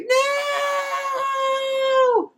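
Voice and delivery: very high hammed-up cry of No; mock anguished scream. Alien woman gets stabbed, very high mock scream. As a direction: ham up a villain's dying scream. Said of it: "she's like".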